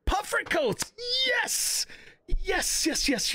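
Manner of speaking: high, gleeful excited cry; thrilled high yelps; excited squeal. Real, not acted. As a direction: squeal with delight at the find. Excited about finding puffer coats.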